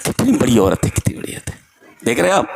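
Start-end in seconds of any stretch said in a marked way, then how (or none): 0.9-1.6 whispered in wonder; soft echoing whisper of awe; awed whisper. Real, not acted. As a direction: breathe it out reverently.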